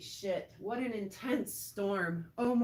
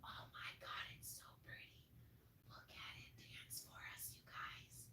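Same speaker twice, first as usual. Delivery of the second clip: whispering in wonder; real hushed, voiceless amazement; awed whisper; 22 dB quieter than usual. Real, not acted. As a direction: drop to a barely-there whisper. Whispers after apologizing for yelling.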